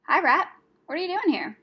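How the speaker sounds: high, loud teasing greeting; playful, high-voiced brownie; cheeky brownie. A narrator's reading. Brownie hails captured rat.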